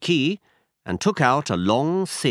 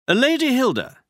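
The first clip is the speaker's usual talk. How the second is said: brisk, higher-pitched detective voice; Holmes voiced higher; 13 semitones higher, 4 dB louder than usual. A narrator's reading. Holmes tells Watson where they go, higher detective voice. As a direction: voice Holmes up high, assured. Holmes addresses Lady Hilda.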